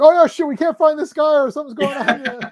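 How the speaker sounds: high, loud panicked backstage cry; hammed-up frantic castmates; mock panic. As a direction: blurt it in a panic. Voicing panicked cast.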